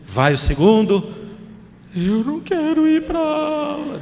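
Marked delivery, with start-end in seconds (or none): 1.9-4.0 put-on very high, slow tearful child's protest; little son whimpering he won't go; imitated wail of a small boy; crying child again. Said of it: "De novo chorando".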